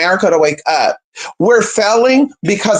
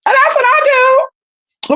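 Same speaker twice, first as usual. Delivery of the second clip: proud declaration; slower than usual. Real, not acted. Proclaiming her own faith.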